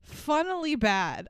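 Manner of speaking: usual speaking voice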